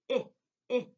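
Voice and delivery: "I" as a short clipped i, like in itch; short I sound. Shown as a demonstration. Teacher to class, demonstrating the short i. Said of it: "It makes the short I sound like this".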